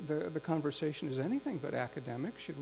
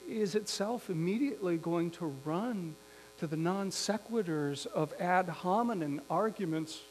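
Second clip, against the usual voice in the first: ordinary delivery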